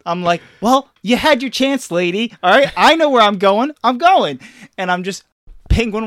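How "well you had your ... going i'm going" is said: put-on high, loud indignant kid; hammed-up stubborn protest; mock defiant backtalk. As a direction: play a kid brushing mom off. Acted quarrel, kid defying mom.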